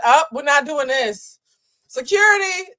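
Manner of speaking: mock indignant. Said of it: "first time that I can relate to Portia".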